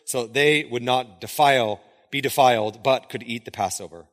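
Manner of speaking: measured, subdued Bible reading; scripture reading. A narrator's reading. Reading the trial before Pilate to a congregation, measured. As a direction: read the passage out evenly, questions lifting. Reads the scripture passage aloud.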